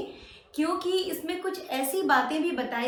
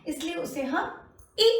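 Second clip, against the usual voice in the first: ordinary delivery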